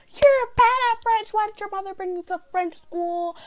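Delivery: high-pitched, hammed-up scolding; mock berating teacher. Teacher berating student in French school, mock scolding.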